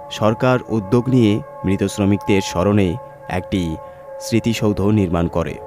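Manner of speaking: usual speaking voice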